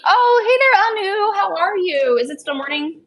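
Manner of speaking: high squeaky pet voice; talking to a dog. Real, not acted. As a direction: greet the pup, high and loud. Greeting dog in baby talk.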